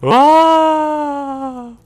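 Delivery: long, held, high-pitched yell; loud high scream. Real, not acted. Gamer crying out mid-game, high scream. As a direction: scream "aah" far above the speaking voice.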